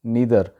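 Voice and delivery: नीदर with a long "ee", nee-ther; native English pronunciation. Shown as a demonstration. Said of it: "गोरे इसको बोलते हैं इस तरीके से".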